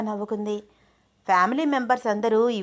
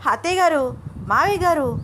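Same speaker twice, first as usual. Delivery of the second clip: high-pitched call to the in-laws; narrated husband's raised, urgent call; loud urgent shout; 6 semitones higher, 4 dB louder than usual. A narrator's reading. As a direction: shout for help through the house. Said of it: "అంటూ గట్టి గట్టిగా అరిచేశాడు".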